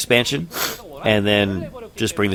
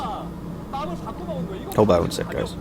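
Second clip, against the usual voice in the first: whispering; 11 dB quieter, much slower than usual. Real, not acted. Stepping away from mic.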